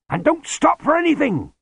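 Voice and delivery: high, loud urgent shout. Acted. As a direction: yell it after him. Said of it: "shouted Lutzer".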